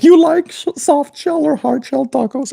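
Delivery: high squeaky mock voice of a random chatter; interrupting chat voice. Chatter butting in with a high squeaky question. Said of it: "someone is like".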